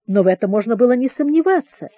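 ordinary delivery

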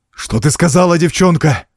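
angry demand. A narrator's reading. Count to the frightened girl, angry demand. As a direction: demand it of the child. Count angrily repeats his question.

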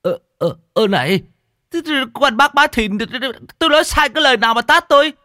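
very high, loud stammering whine; wounded, flustered squawk; aggrieved protest. Acted. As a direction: protest the slap in a squeaky stutter. Slapped man protests to his attacker.

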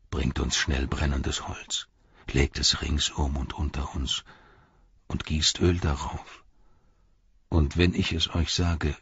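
hushed final instructions; quiet voice. A narrator's reading. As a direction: give grave orders softly, fading at the end. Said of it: "Denethor sagte mit leiser Stimme".

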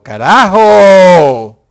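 slow, loud stretched carajo; drawn-out shout. Real, not acted. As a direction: drag out carajo in a loud groan of disbelief. Exclamación alargada. (carajo alargado, muy lento y fuerte)

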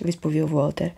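ordinary delivery, nothing marked

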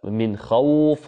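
من خوف slowed, the و of خوف held; slow model recitation of من خوف; lengthened vowel demo. Shown as a demonstration. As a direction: stretch خوف on its و, slowly. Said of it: "ضربنا له مثالًا".

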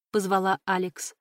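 ordinary delivery

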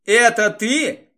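hoarse, strained voice; hoarse croak. Acted. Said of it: "прохрипел маг тьмы".

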